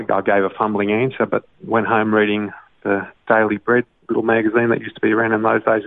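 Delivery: ordinary talk, nothing marked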